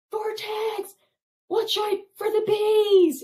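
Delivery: high, loud call across a distance; calling out a warning. Retold or imitated. Warning Vortex from across the mountain, loud high shout. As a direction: yell a warning to a friend far off.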